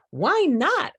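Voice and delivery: high, eager little pig voice; curious question. A narrator's reading. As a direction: ask it brightly like a small pig. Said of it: "asked Rufus".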